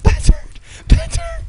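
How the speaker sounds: shrieking very high and loud; frantic, squealing cries; screaming panic. Real, not acted. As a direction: scream for help in a frenzy. Sudden danger, calling for help.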